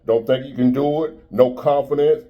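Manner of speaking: ordinary delivery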